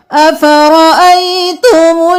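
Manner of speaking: slow, chanted tajweed recitation; Quranic recitation. Shown as a demonstration. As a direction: chant it slowly, stretching the vowels. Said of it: "full verse recited after drill".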